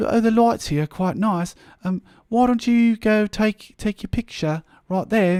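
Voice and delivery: high, hammed-up British accent; terrible English accent. Retelling the merchandiser's photo suggestion, terrible English accent. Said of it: "terrible English accent".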